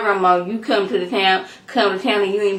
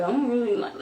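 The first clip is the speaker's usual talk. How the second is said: softly mimicked nerves; hesitant, quiet mock protest; nervous reluctance; 3 semitones higher, 7 dB quieter than usual. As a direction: back out timidly, lightly acted. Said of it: "he acting all nervous".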